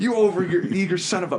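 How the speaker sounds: high, fast exasperated sputter; movie scene reenactment. Acted. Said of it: "let's hear it. Love this part".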